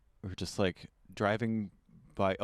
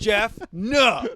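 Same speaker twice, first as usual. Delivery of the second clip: loud, higher mock scolding; 10 semitones higher, 9 dB louder than usual. Comedians shutting down Jeff, loud mock scold. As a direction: scold him like a bad dog. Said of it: "And go".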